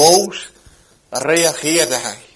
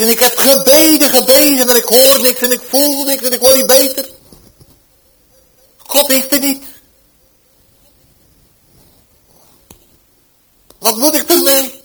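desperate plea; 9 semitones higher, 8 dB louder than usual. Retold or imitated. Dying man's desperate complaint.

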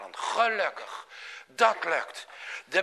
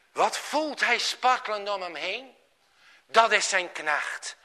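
plain reading voice

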